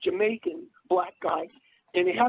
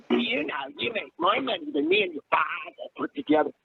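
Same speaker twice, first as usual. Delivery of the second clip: put-on stepmother's Boston accent, pitched up; mock Boston accent; 5 semitones higher than usual. Son mimicking his stepmother nagging him about money, mock Boston accent. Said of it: "She'd say it like this".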